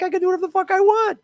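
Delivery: high, fast mocking Biden voice; hammed-up smug Biden impression; mocking Joe Biden. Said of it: "Joe Biden is like".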